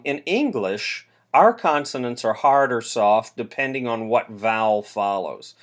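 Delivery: normal talking voice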